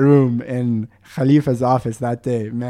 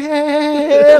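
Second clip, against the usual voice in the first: high-pitched bewildered mock chuckle; confused reaction; 11 semitones higher, 5 dB louder than usual. Ali gets his hand shaken, confused and high. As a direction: imitate someone playing along, baffled. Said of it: "the dude is so confused".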